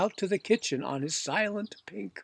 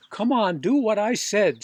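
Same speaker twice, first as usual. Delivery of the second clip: louder, higher, pushing him to obey; louder, higher Popper pressing the serviceman; impatient command; 5 semitones higher, 7 dB louder than usual. Acted. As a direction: come on, get on with it.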